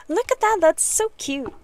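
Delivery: high, loud gush over something cute; bright excited gush at a cute find; delighted squeal. Real, not acted. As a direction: coo with delight at the little thing. Delighted at cute game item.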